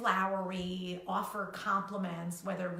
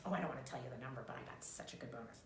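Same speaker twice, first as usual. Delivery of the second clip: lower, much softer and very fast, fading to a whisper; mock modest brag; 7 semitones lower, 10 dB quieter, much faster than usual. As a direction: brag while pretending to hide it. Fake modest bragging aside.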